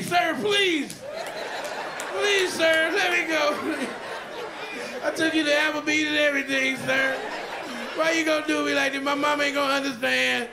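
high begging plea. Retold or imitated.

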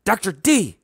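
high urgent call; high-pitched alarmed shout; alarmed cry. A narrator's reading. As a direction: cry out for his attention. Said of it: "I cried".

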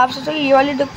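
ordinary delivery

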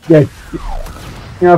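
ordinary talk, nothing marked

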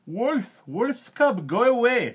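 high, very loud banishing cry; shrieked, high-pitched command; priest shouting. A narrator's reading. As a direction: shout to drive off a demon. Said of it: "shouted the priest".

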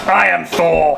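mock-heroic loud declaration; a playful, booming boast; Thor. Playing Thor while hammering steaks.

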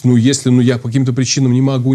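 normal talking voice

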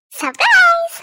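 loud, high playful surprise yell; cheeky prank call surprise; surprise shout. Acted. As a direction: jump out at her with a shout. Prank call startling friend.

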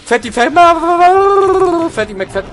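high, loud frantic repeating; panicked yelling. Real, not acted. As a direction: shriek the name while fleeing. Panicked calling while chased.

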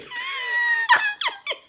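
shrill burst of laughter; high squealing laugh. Real, not acted. Reactor laughing at her own guesses.

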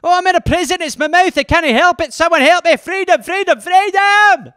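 high, loud, hammed-up Scottish wail; Scottish accent. Trapped in his own mouth and begging for help, Scottish accent. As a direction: cry out for freedom in a mock Scottish accent. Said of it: "Or Scottish".

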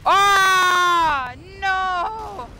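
loud, high cry of defeat; dismayed wail. Real, not acted. Dying in the game.

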